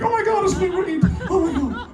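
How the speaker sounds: high starstruck gushing; starstruck teenage girl. Retold or imitated. Retelling spotting [name] with [name], high gushing fangirl voice. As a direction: gush like a fourteen-year-old fangirl. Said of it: "like fourteen-year-old girls".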